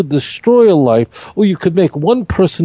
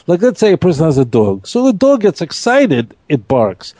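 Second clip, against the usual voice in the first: ordinary delivery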